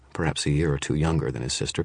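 ordinary delivery

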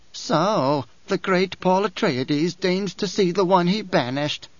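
lightly voiced haughty old priestess; a hint of a proud crone sneering at Paul; old Reverend Mother, disdainful. Reverend Mother's lines in reading.

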